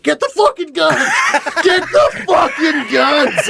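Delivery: high, loud, drawn-out mock scream; retold panicked screaming. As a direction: yell for help as if losing it, half laughing. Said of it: "I'm screaming for my roommate".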